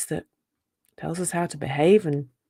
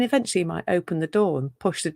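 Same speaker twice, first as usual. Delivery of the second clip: ordinary talk, nothing marked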